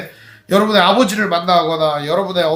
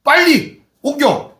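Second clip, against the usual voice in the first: higher, slow businesslike command; brisk order; 7 semitones higher, 3 dB louder, much slower than usual. Acted. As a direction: Joseph cutting the tears short, drawn out. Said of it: "뭐라고 하냐?".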